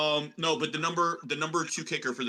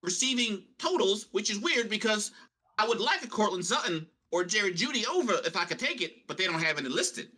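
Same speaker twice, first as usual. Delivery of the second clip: put-on higher-pitched prospector accent; Deuce Chiggins; 4 semitones higher than usual. Co-host explains missing betting lines, prospector voice. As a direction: keep up the hammy prospector act. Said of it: "I don't know what accent I'm doing".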